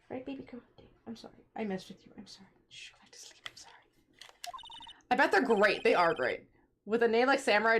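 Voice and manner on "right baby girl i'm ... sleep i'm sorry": much softer, hushed apology to a pet; whispering over a crib. Real, not acted. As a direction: whisper so she stays asleep. Hushing a sleeping dog.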